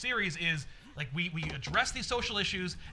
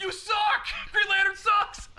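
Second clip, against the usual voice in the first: high, loud taunting yell; mock heckle; 14 semitones higher, 6 dB louder, slower than usual. Green Arrow jeering at Green Lantern, shouted in mockery. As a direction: yell abuse from the crowd.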